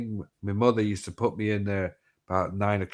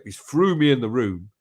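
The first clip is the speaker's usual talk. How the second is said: ordinary delivery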